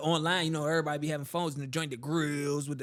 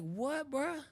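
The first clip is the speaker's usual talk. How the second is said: stunned disbelief; 9 semitones higher than usual. Retold or imitated. Hearing his cousin is gone.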